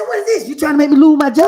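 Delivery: high, loud outraged yell as the lawyer. Retold or imitated. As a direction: blow up at the client at full volume. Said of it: "Who you yelling at".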